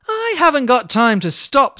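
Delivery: snapping. Acted. Olga snapping at the mouse in her rush. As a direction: bite it off impatiently. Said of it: "snapped Olga".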